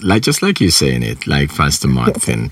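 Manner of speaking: normal talking voice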